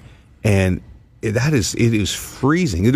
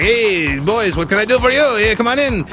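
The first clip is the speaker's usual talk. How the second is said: put-on high-pitched, muddled European accent for a club manager; vague foreign accent; 13 semitones higher than usual. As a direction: greet them loosely in an unplaceable foreign accent. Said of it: "I don't know what accent I'm doing".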